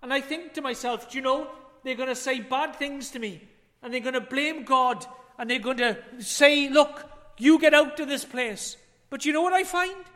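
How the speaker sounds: ordinary talk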